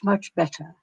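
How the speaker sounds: ordinary delivery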